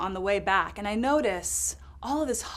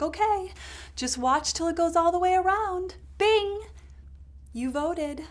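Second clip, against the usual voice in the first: much higher, bright sing-song official; chirpy poll woman; 8 semitones higher than usual. Retold or imitated. Poll woman cheerfully guiding the voter, much higher voice. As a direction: play a chirpy helpful clerk. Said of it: "she's like".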